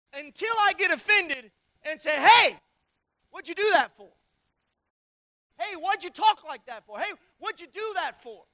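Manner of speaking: loud, indignant hey; mock offended complaint. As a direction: play the bumped, annoyed man.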